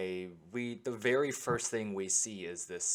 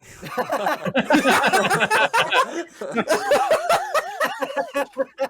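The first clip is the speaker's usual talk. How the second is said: cheek flick pop, high and loud; a clean sound-effect mouth pop; wet mouth pop sound; 14 dB louder than usual. Real, not acted. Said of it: "That was not a sound effect".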